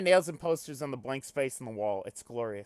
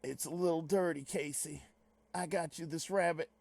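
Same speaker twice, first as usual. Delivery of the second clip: put-on Southern accent; 3 semitones higher, 5 dB quieter than usual. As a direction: imitate a movie hero's Southern accent. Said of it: "his fucking southern accent in that movie".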